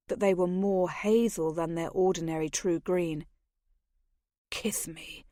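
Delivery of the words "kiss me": whispered, urgent command. Acted. The lord whispers his urgent demand to Maggie. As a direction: breathe the demand at her.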